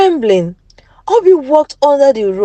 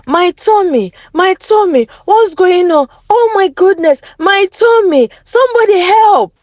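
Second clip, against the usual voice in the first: put-on high, pained cries from hurting children; crying out in pain; 8 semitones higher than usual. Poisoned children crying out to anyone for help, in pain. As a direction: wail it like your stomach is cramping. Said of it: "they began to cry out in pain".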